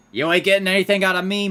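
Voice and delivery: fast, loud, high taunt; defiant shout. Pretend. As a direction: yell it back at the Mafia, defiant. Defying the Mafia in game.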